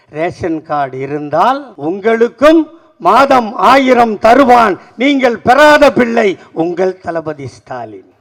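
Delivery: loud rhythmic declamation of questions and answers; ringing political platform speech; rousing stump oratory. Acted. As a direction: build it like a rally litany. Formal proclamation to the crowd.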